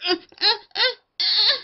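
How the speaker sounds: high, forced little fake sobs; mock sobbing. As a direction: squeeze out short, high boo-hoos. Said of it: "ekes out a tear".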